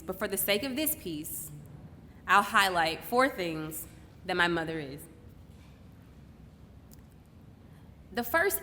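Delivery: deliberate, poetic stage delivery with long pauses; spoken-word monologue. Acted. Daughter honouring her mother on stage, measured spoken-word delivery. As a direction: let each phrase land before the next.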